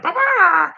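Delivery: high-pitched creature babble, playfully put on; high mock bleating. Speaker imagining Ferbus in a chair, high babbling. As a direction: squeal out high nonsense syllables.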